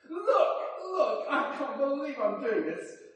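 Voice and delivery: high, loud and laughing with joy; giddy, delighted outburst; joyful disbelief. Acted. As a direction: exclaim it as if amazed at yourself. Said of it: "Can you hear the joy in his voice?".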